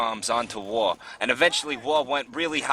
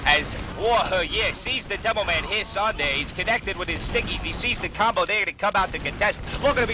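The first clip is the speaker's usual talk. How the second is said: higher, keyed-up live game call; excited play-by-play; 6 semitones higher than usual. Real, not acted. Caster follows War Hurrier into the fight, excited.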